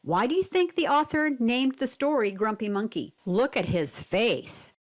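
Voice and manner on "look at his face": slow, deliberate look-here voice; slowed teacher cue to study the picture; slow pointing-out. Shown as a demonstration. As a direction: draw the reader's eye slowly.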